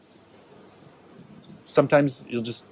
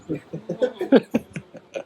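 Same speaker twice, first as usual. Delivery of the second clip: high giggle; 9 semitones higher than usual. Real, not acted. Laughs at his own story.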